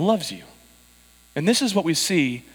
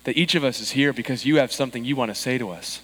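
prayer; 4 semitones lower than usual. Acted. Pastor's opening prayer.

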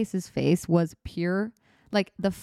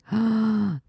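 delighted gasp; 4 semitones higher than usual. Real, not acted. Sees the koala bear.

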